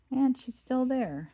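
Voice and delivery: high, loud little bear shout; baby bear cry. Acted. Baby Bear finding Goldilocks in bed, high loud cry. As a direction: squeal it out like the smallest bear.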